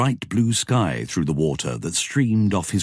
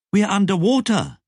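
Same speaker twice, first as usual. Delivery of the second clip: called back; 4 dB louder than usual. A narrator's reading. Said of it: "Lobsang called back".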